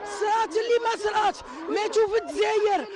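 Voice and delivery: angry crying. Real, not acted. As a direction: cry out in grief and fury. Grieving mother crying out, angry. (بكاء غاضب)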